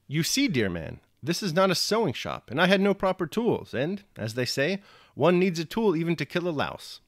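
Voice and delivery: a hint of a cheerful old soldier; Platon explaining. A narrator's reading. Platon to the Frenchman excusing his tools, folksy.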